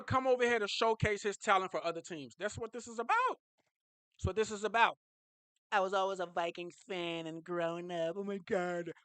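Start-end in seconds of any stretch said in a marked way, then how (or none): 5.7-8.9 a lightly put-on voice of the player; mild mock player voice; mocking Kyler Murray. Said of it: "that's how I talk".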